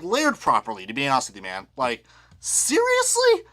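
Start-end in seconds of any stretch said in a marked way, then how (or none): 1.8-3.5 high, slow, drawn-out disbelief; exasperated disbelief. Real, not acted. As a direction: stretch it out, can't believe it. Exasperated at bad decals.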